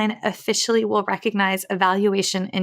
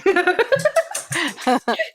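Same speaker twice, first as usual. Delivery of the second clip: bright high laugh bursting out; 9 semitones higher than usual. Real, not acted. Laughing at own story.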